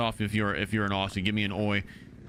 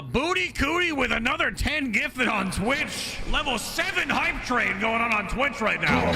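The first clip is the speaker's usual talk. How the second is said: excited, louder and higher hype call; hype announcement; 9 semitones higher, 3 dB louder than usual. Real, not acted. Streamer hypes a Twitch gift train to chat, excited. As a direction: pump up the chat for the gifts.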